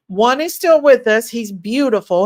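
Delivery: ordinary delivery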